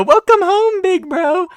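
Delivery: very high falsetto squeal of a greeting; coşkulu karşılama. Pretend. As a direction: squeal a drawn-out, mock-gushing welcome. Mock greeting to older brother.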